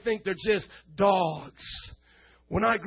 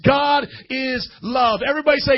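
loud, emphatic preacher voice calling to the congregation; preaching at full force; 8 dB louder than usual. Acted. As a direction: preach it out to the whole crowd.

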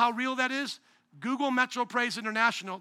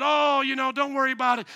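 louder, breezy dismissive voice of the man; dismissive brush-off; 3 semitones higher, 6 dB louder than usual. Retold or imitated. Man brushing off the pastor's warning, loud breezy dismissal. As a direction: wave it off carelessly. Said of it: "He then said".

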